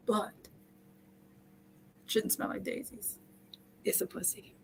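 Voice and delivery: soft unvoiced deadpan whisper; whispered punchline. Real, not acted. Host to listeners on hygiene, blunt punchline in a whisper. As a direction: drop it to a whisper for the joke.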